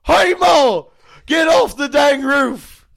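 hillbilly holler, rising to a shout; very high, loud yokel voice; Cletus. Acted. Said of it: "And he goes".

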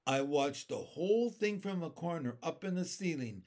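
ordinary delivery

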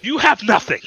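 ordinary delivery

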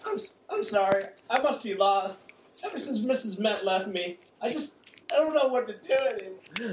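put-on high, mopey drunk voice; sad drunk. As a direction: whine like a lonely drunk. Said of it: "drunk guy at the bar".

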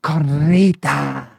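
Carnitas said slowly, the R rolled. Shown as a demonstration. Explaining the feel, slow rolled Carnitas. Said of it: "you're rolling the R's".

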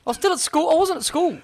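high, loud comic protest; high-pitched protest. Pretend. Co-host excusing himself for missing an old show, high-pitched protest. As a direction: squeal it in mock defence. Joking protest after working out the year.

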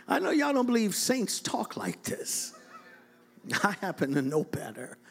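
normal talking voice

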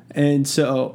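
ordinary talk

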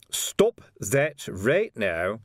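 slow, deliberate telling-off; stern order. A narrator's reading. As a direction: cut him short, spacing out the words. Sergeant cuts off comic gravedigger.

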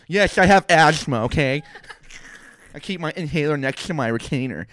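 loud, high exasperated admission; defensive exasperation. Retold or imitated. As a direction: snap back defensively, laughing. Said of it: "I'm like".

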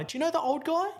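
a hint of a surprised receptionist; lightly voiced receptionist. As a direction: ask it up high and curious. Receptionist asking, voiced higher.

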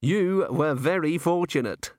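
fussy, precise thin man; prim. A narrator's reading. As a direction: measure it out very properly. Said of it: "said the thin man primly".

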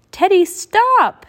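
loud, high, drawn-out plea to stop; urgent shout. A narrator's reading. Jack begging Teddy to stop the rhyme, urgent shout. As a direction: shout the name and stretch the command.

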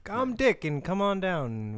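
high, hammed-up storefront invitation; store pitchman. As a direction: beckon customers into your shop. Pitching an imagined lollipop store.

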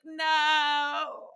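high, drawn-out comic no; mock dismayed wail. Girl learns crush was only pranking her, mock devastated. Retold humiliation after prank.

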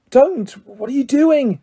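high, urgent, held-in alarm; hissed. A narrator's reading. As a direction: hiss it in panic to stop him. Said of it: "Harry hissed".

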